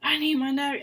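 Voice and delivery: high-pitched demanding imitation; mock yelling customer. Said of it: "She starts yelling".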